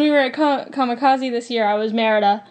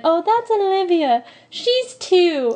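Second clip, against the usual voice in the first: higher-pitched voice of a helpful sister; imitating child's sister; 7 semitones higher, slower than usual. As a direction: introduce a toddler in a raised, imitated voice. Voices the little girl's sister.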